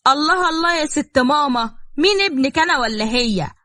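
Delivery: high-pitched mock-indignant protest. A narrator's reading. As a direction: pipe up in protest, easing down on the final question. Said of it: "لصوتهم العالي". (احتجاج بصوت عالي)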